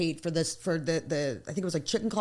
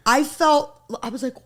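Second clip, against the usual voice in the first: usual speaking voice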